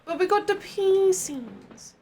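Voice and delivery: high, drawn-out whine; whiny protest. Pretend. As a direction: wail it slowly like a thwarted child. Whining protest at being stuck.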